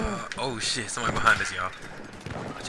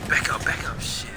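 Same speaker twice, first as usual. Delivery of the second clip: urgent whisper; 6 semitones higher than usual. Real, not acted. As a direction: hiss it so nobody hears. Hiding from enemy.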